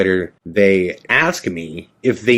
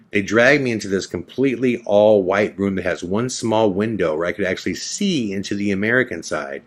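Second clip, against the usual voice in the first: usual speaking voice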